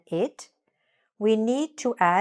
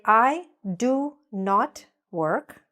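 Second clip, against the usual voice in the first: slow, deliberate full form; slow clear model; much slower than usual. Shown as a demonstration. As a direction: spell it out with your voice. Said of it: "So we say".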